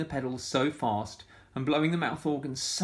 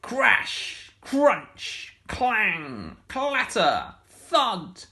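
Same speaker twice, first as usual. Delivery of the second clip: high, loud crash words; loud crash sounds; 11 semitones higher, 7 dB louder, much slower than usual. A narrator's reading. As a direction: bang out each crash noise.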